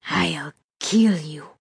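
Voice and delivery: slow villain's death threat; menacing threat. A narrator's reading. Gunman with pistol under captive's chin, slow menace. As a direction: draw the threat out, word by word.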